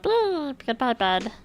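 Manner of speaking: high little puppy voice on Beep. Retold or imitated. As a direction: squeak it like the dog. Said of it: "The puppy said".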